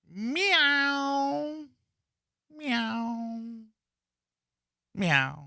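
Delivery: a weak high meow instead of a roar. Retold or imitated. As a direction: give a tiny kitten meow for a roar. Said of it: "Let me give you my roar".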